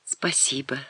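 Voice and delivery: soft, voiceless whisper; whispered thanks. A narrator's reading. Natasha thanks the baron, unsure what else to say, whispering. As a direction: whisper it, barely any voice. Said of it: "прошептала Наташа".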